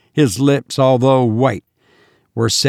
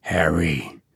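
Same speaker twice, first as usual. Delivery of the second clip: lower, soft hushed call; whispered; 8 semitones lower, 7 dB quieter than usual. A narrator's reading. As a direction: whisper his name close by. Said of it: "whispered Hammond".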